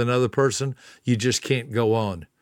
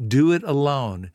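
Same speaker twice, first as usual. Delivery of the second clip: ordinary talk, nothing marked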